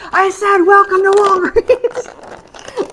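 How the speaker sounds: high, loud, slow repeated yell; yelling it again. Retold or imitated. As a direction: spell it out slowly and loudly for a deaf listener. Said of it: "the lady yells".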